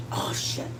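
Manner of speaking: breathless whispered oh shit under the breath; whispered curse. Real, not acted. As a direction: mutter it to yourself in a whisper, no voice. Muttered aside mid cooking.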